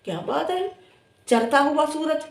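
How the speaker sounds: high-pitched, sarcastic praise; mock admiration. Teasing a rival about her boast, mock admiration. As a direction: gush in a high voice, making fun.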